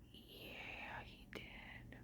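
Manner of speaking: whispered aside. Real, not acted. Barely audible aside.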